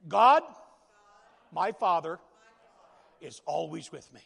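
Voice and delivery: God belted out loud, then the rest falls back down; a loud model line for the congregation to repeat; declaring to the congregation. Shown as a demonstration. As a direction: proclaim it, with God called out loud. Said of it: "I want you to say this today".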